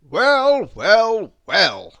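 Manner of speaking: loud exclaiming bird, stepping down in pitch; blackbird character. Acted. Blackbird arriving at the chaotic farm, loudly exclaiming. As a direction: call out each word big and theatrical. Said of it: "said Charles the blackbird".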